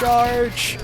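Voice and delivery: high, loud shout of Charge!; a sudden charging yell; battle cry. Real, not acted. Rushing into the firefight.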